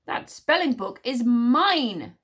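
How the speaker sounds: loud, drawn-out possessive howl. A narrator's reading. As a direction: howl it, stretching the last word. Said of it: "howled Tsar".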